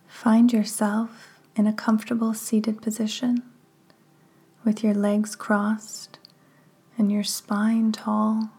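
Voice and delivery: measured, hushed phrases that leave room to breathe; guided meditation. Real, not acted. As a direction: guide them gently into relaxation, unhurried.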